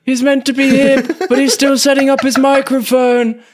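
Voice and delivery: put-on high, confused old podcaster; put-on elderly resident. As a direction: explain it in a high comic old voice. Playing Mister Pepper to care staff.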